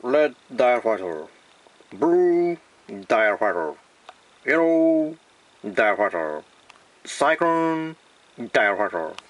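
slow, drawn-out English product names; mock English accent. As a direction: announce toys like an English ad. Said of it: "英語っぽく言ってみます".

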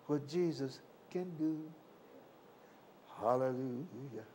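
ordinary talk

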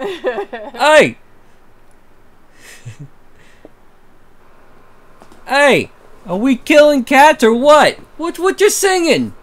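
Pepper voice. Acted. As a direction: belt it out as Pepper. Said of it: "I just gotta channel Pepper".

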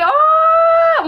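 long, very high "oh"; loud-ish, sustained high coo; high squeal. Real, not acted. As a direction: squeal a drawn-out "oh". Reacts to a viewer's birthday.

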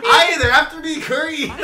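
high, loud mock leprechaun. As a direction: squeal it like a cereal-box leprechaun. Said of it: "sound like a leprechaun".

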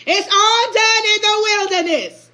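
high, loud preaching; preaching shout. Real, not acted. Preacher shouting at the congregation about walking. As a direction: drive it home at full force.

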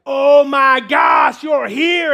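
loud, high, slow joyful cry; overjoyed shout. Retold or imitated. DJ greeting the pastor at the door, loud joyful shout. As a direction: greet him like a long-lost friend. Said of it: "He's like".

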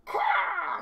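loud, high crow squawk; crow caw. Acted. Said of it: "said the crow".

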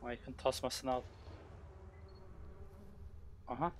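normal talking voice

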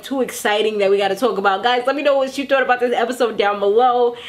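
normal talking voice

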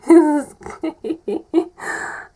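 high giggling burst of laughter; amused giggle; delighted laugh. Real, not acted. Laughing at Ezio.

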